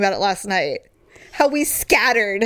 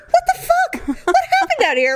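much higher, laughing as the husband; laughing mock confusion. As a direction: squeal it through laughter. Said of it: "he's like".

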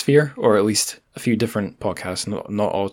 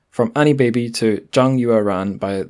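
normal talking voice

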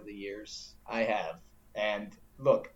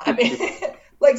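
laughing high and loud; 12 semitones higher, 9 dB louder than usual. Real, not acted. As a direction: laugh through the words. Laughing at own point.

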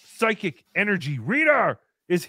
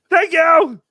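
loud thanks; 8 semitones higher, 11 dB louder than usual. Real, not acted. Thanking a donor again, louder.

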